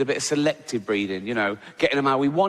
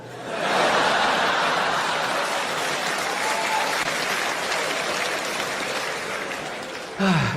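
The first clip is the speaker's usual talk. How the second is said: long laughing sigh, unvoiced; long breathy laugh. Real, not acted. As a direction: let out a long wheezing laugh. Laughter after punchline.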